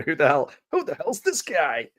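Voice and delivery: a light New York accent, mock tough. A stranger in Cumbria wearing the T-shirt, in a mild New York accent. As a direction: punch it out like a New York guy. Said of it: "like, a New York accent".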